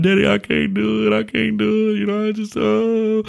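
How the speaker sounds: put-on high, whimpering little boy voice; imitating scared crying kid. Dad imitating his scared son refusing to jump, high and whiny. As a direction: mimic a frightened child on the roof edge. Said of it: "He starts crying".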